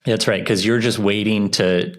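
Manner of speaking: ordinary talk, nothing marked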